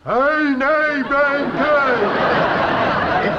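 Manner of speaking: "Hey, nay, brown cow" with flat broad peasant vowels; rough elocution attempt. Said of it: "It's better".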